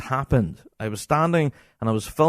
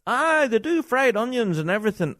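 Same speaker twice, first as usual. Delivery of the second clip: a lightly voiced chatty Scottish punter, pitched up; mock chatty spectator; 8 semitones higher than usual. A fan chatting through the band in a mild mock punter voice.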